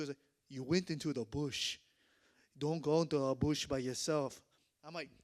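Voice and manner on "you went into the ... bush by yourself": put-on stern warning in African-accented English; imitated African English accent. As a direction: imitate an African local cautioning a foolish visitor. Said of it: "the guy that speaks English".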